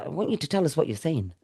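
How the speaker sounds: normal talking voice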